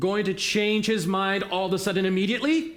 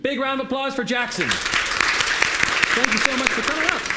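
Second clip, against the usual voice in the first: high, loud emcee cheer; applause call; 6 semitones higher, 5 dB louder than usual. Real, not acted. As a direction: send the volunteer off to applause. Host calls for applause.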